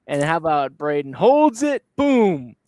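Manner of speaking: rising pitch into a loud "Boom"; excited goal call. Real, not acted. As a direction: punch it like a highlight call. Calls the goal on the replay.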